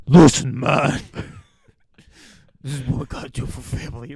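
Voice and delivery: loud first word, pitch near own voice; lightly voiced little tough guy, breaking into laughter; nine-year-old tough guy. Co-host trying the child star again, lightly voiced tough guy. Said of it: "nine-year-old Bob? ... it's better than".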